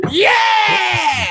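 high, long-held yell; exultant cheer. Real, not acted. Fan carried away by his boast, exultant long yell.

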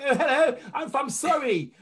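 put-on posh English accent. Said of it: "put on a posh English accent".

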